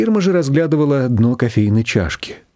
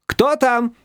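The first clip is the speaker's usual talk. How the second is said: calling out; 12 semitones higher than usual. A narrator's reading. Shushana calling out to whoever is at the door. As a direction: call it down a corridor. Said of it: "крикнула она в коридор".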